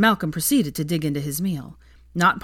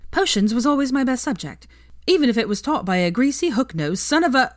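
higher, louder, sardonic; hint of a brash witch; Veronica voice; 7 semitones higher, 4 dB louder than usual. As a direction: tease with a sharp edge.

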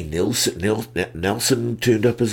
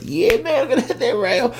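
excited. Retold or imitated. Excited self quote at rail.

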